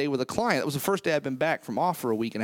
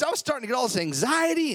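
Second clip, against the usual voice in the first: normal talking voice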